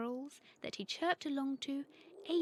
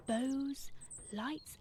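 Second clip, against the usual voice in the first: ordinary delivery, nothing marked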